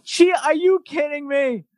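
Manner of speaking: lampoon a paranoid critic's disbelief; hammed-up exasperated squawk; mock outrage. Mocking the conspiracy critics.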